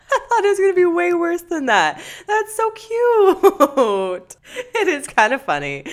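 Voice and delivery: high, loud laughing squeal. Real, not acted. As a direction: laugh through every word. Reacting to kindergarten kiss story.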